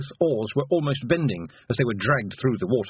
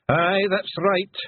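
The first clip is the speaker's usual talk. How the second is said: higher Scots pixie voice; broad Scottish accent; 9 semitones higher, 3 dB louder, much slower than usual. Acted. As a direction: Rob Anybody's Scottish brogue. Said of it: "said Rob Anybody".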